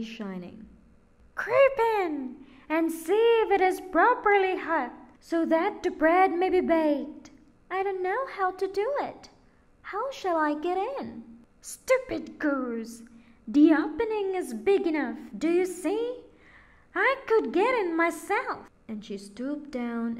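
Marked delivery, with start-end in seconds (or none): 1.3-7.3 high, loud witch voice urging; sly witch. A narrator's reading. Witch urging Gretel into the oven, high and loud. 7.7-11.2 put-on high, quick, innocent child voice; innocent girl. A narrator's reading. Gretel pretending not to know, high innocent voice. 11.6-18.7 high, loud, impatient witch voice; scolding witch. A narrator's reading. Witch scolding Gretel at the oven, high and loud.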